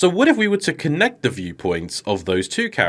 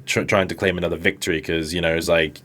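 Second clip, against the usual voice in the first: ordinary talk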